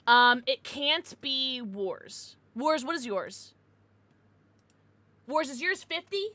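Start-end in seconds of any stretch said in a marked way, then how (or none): none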